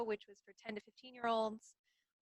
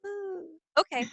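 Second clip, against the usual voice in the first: hesitant surprise; 8 semitones higher, 8 dB louder than usual. Retold or imitated. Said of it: "I was like".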